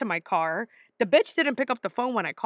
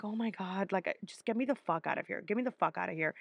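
mortified mutter; 3 semitones lower, 8 dB quieter, faster than usual. Retold or imitated. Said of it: "And I was like".

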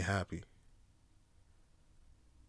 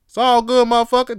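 high, loud hype-man shout; hyped demo; 10 semitones higher, 10 dB louder than usual. Shown as a demonstration. Showing listeners the greeting, loud hype. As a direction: show them how to say it, loud. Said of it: "Just dap 'em up".

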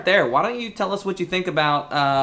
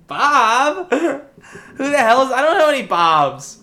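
laughing mock outrage; 11 semitones higher, 6 dB louder, slower than usual. Mock outrage at unknown guest.

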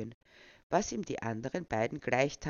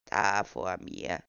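put-on lower, slow old man's voice; feeble old voice; 8 semitones lower, slower than usual. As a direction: play the doddering old man. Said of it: "den altersschwachen Tonfall beizubehalten".

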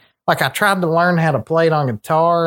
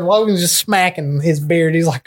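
usual speaking voice

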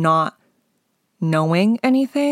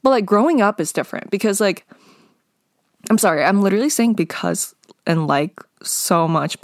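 normal talking voice

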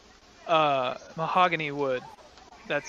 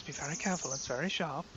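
put-on soft, careful warning to a child; hushed, gentle warning from a father; quiet warning; 2 semitones lower, 9 dB quieter than usual. Father warns handing over sword.

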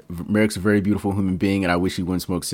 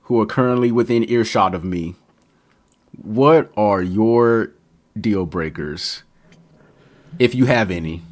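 mock host announcer; 3 semitones higher, slower than usual. Mock host addressing audience.

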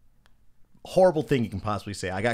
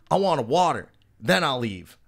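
raised, slightly louder mock demand; imitated belligerent demand; 5 semitones higher, 4 dB louder than usual. Belligerent guest bargaining with the man removing him, imitated demand. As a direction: play the stubborn troublemaker lightly. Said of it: "he's being belligerent".

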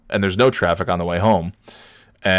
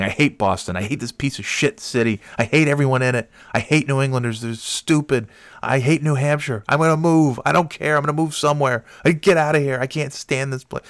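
retold commute tirade, climbing higher in pitch; mock screaming rant; 6 semitones higher than usual. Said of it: "and I start screaming".

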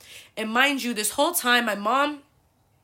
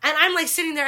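ordinary talk, nothing marked

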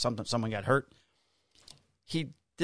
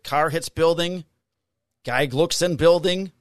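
put-on slow, loud, word by word as if to a child; slow spelled-out explanation; 5 semitones higher, 7 dB louder, slower than usual. Cop spells out the video to the complainer, slow and loud. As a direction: lay it out slowly and pointedly, louder. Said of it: "spelling it out slowly to her".

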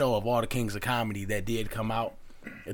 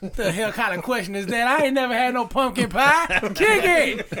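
much higher and louder, laughing through the punchline; Bernie Mac joke; 6 semitones higher, 4 dB louder than usual. Retold or imitated. Retelling Bernie Mac's stage joke to friends, loud laughing impression. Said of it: "one of his jokes was like".

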